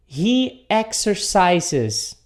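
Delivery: He exercises, spelled out very slowly with a clear final S; slow model sentence. Shown as a demonstration. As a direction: drag out every syllable of exercises. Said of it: "um som de S". (pronúncia bem lenta de "exercises")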